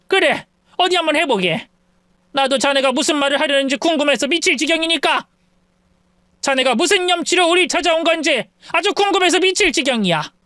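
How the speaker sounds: goading him to confess; sarcastic dare. Acted. Mother-in-law daring. (비꼬며 재촉하는 장모)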